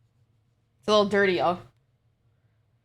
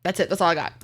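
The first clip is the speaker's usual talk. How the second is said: ordinary talk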